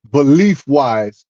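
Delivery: ordinary delivery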